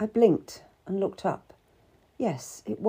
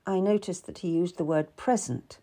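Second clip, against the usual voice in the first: usual speaking voice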